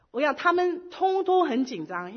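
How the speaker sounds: ordinary talk, nothing marked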